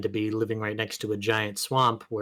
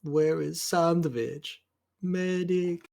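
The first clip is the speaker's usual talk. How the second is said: slow, raised put-on voice; game character impression; 6 semitones higher, slower than usual. Quoting Heavy asking for a sandwich and medic, game character impression. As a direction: call out in a drawn-out character voice.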